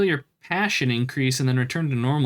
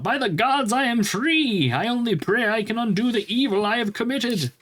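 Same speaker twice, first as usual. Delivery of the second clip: freed man calling out; 9 semitones higher than usual. Acted. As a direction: cry out in relief at being freed. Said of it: "a man's voice calls out".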